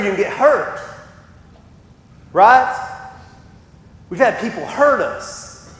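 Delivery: loud, rising preacher's build; fiery pulpit preaching; full-force preaching. Acted. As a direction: drive it home to the congregation.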